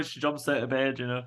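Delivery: normal talking voice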